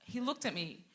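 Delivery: normal talking voice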